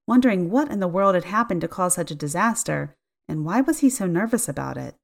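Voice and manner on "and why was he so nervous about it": ordinary delivery, nothing marked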